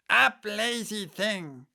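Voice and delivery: high, slow commanding queen voice; bossy queen. Acted. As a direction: bark the order like a savage queen. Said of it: "said the queen".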